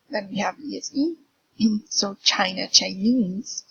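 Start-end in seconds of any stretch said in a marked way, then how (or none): 2.2-2.7 China with the stress on "Chi"; China, strong "Chi" and weak "na"; stress on first syllable. Shown as a demonstration. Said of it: "is in the first syllable". 2.7-3.6 Chinese with the stress on "nese"; Chinese, light "Chi" and strong "nese"; stress on last syllable. Shown as a demonstration. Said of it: "is in the last syllable".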